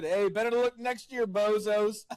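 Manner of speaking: mocking taunt. Taunting losing fans.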